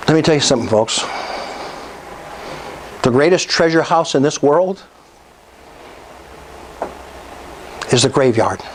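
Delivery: low-pitched, grave inspirational speech; low, measured appeal to the audience; solemn motivational preaching. Real, not acted. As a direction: let each phrase land, with long pauses. Solemn motivational sermon passage.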